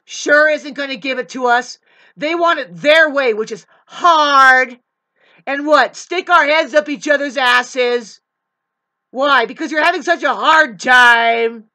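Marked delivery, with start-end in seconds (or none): 2.2-11.6 loud angry rant. Real, not acted. Escalating rant against narcissists.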